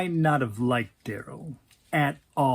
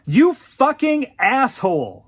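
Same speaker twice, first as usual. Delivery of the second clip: loud, high, furious insult; shouted; 8 semitones higher, 8 dB louder than usual. Acted. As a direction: really let him have it. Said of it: "And she shouted".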